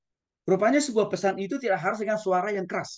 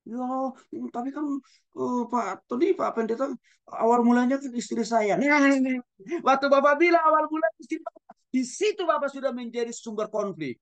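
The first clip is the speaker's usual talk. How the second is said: higher, protesting husband; mock complaining husband; 7 semitones higher than usual. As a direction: act the husband pleading his case. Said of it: "husband complaining to pastor in mediation". (suami yang mengeluh kepada pendeta, nada tinggi)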